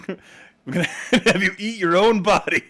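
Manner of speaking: words broken by laughter, loud and high; cracking up mid-joke; laughing. Real, not acted. Gamer laughs while riffing on a gross challenge.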